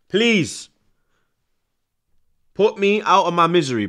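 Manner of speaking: high, loud begging; loud pleading. Real, not acted. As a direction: beg at full volume, fed up. Fan begging ref to end.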